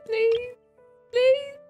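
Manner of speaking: usual speaking voice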